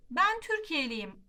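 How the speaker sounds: usual speaking voice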